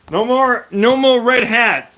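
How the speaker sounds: high and loud, a touch slow; mock defeated declaration. Real, not acted. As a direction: announce the surrender to the chat with a grin. Giving in to chat about hat.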